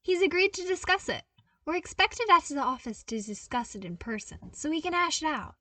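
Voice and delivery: put-on beaming young man sharing news; bright and cheerful. A narrator's reading. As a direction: rattle off the good news with glee. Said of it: "He smiled brightly".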